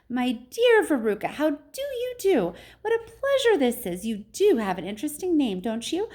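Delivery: high, effusive greeting; high-pitched, delighted storybook host; excitable factory owner, gushing. A narrator's reading. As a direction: gush in a high, fluty voice.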